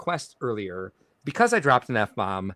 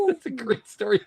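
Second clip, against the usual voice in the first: laughing through it, pitched much higher; 3 dB louder than usual. Real, not acted. Host reacting to viewer's story, laughing high. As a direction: let the laugh carry the words up high.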